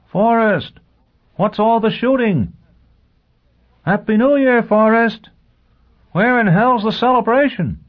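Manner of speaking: put-on high, loud drunken revelers calling; calling from below. A narrator's reading. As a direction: shout up the stairs like partygoers. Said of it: "Voices below began to call".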